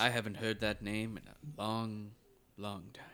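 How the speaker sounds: put-on slow, trailing old Jedi voice; Alec Guinness. Host quoting the old Jedi remembering a name, slow imitated voice. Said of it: "Sir Alec McGuinness".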